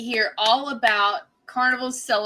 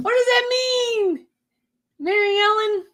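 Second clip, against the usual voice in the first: high, wailing mock plea; comically helpless high-pitched wail; playful pleading; 11 semitones higher than usual. Real, not acted. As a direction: whine it high, begging for the answer. Mock plea to viewer for help.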